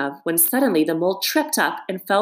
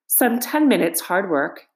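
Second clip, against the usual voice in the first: plain reading voice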